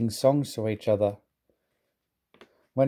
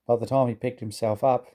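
ordinary delivery

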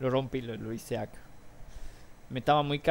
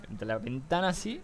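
ordinary delivery

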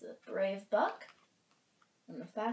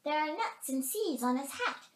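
high, loud bird invitation; excited chickadee; 9 semitones higher, 6 dB louder than usual. A narrator's reading. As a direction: call the others over in a piping voice. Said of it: "said the chickadee".

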